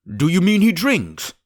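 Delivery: high, loud shocked question; startled cry. Acted. Squire hearing the mate drinks, shocked loud cry. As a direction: squire blurts it out in alarm. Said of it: "cried the squire".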